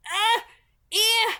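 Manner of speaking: high, loud startled yelps; startled cries. A narrator's reading. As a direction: cry out in sudden fright. Startled by shadow at window.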